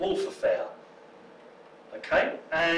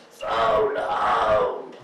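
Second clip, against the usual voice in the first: E.T. impression; 7 semitones lower than usual. Speaker answering woman with blow-up E.T. doll, mock alien voice. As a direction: call it out as a mock E.T. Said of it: "I looked at her and I went".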